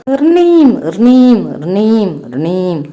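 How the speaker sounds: ringing call sound that fades out; phone ring imitation. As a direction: mimic a ringing string phone dying away. String phone ringing in story.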